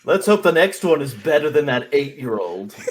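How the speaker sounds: loud taunting gamer jeer; panicking gamer. Acted. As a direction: jeer at the crying kid, loud and high. In-character gamer trash talk.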